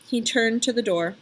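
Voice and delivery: usual speaking voice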